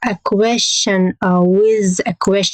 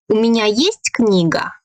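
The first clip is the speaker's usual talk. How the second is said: sharp rise on "есть", then drop; rising question intonation. Shown as a demonstration. Teacher demonstrating a Russian question to learners, pitch jumping up on "есть". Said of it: "here we have a rising intonation".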